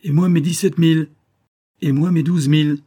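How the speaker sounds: high, loud demands from a crowd; clamouring. A narrator's reading. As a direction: call out to claim your share. Players clamour for their money.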